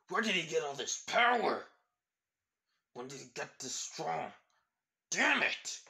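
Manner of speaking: furious, high yelling; angry shouting. Acted. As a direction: shout it in frustrated rage. Rival shouts in frustration.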